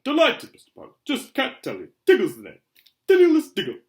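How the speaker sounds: high, loud, overexcited introduction; delighted fan. A narrator's reading. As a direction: burst with delight at meeting your hero. Excitable fan introduces himself.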